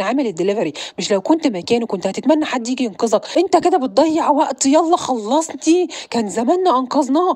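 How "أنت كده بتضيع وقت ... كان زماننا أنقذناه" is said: louder, higher, pushing him to hurry; exasperated friend hurrying his partner along; impatient urging. Acted. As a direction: snap at him to get moving.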